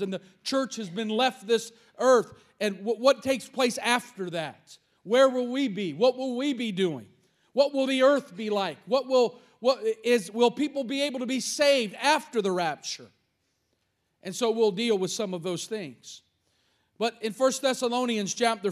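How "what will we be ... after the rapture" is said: ordinary talk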